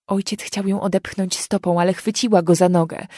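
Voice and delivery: ordinary delivery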